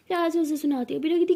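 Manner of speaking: plain reading voice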